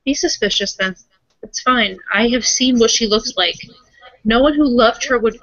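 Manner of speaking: ordinary talk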